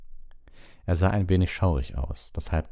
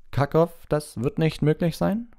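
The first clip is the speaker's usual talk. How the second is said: placating official; 12 semitones higher, 4 dB louder than usual. A narrator's reading. Official placates an angry headmaster. (beschwichtigender Beamter, hohe Stimme)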